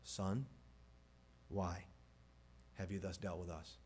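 low, quiet, measured reproach; parental authority. Acted. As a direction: question a son with restrained authority. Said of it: "You can hear in Mary's voice that very familiar tone of authority".

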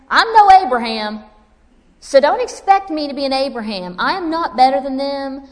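loud, high, desperate protest; Elijah's outburst. Acted. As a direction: cry out like a worn-out prophet. Preacher voices Elijah's despair.

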